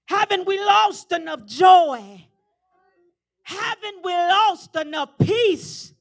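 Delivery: loud, high and slow, each line hammered; preaching refrain. Acted. Preacher pressing the congregation with questions, loud and slow. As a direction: drive every question home slowly.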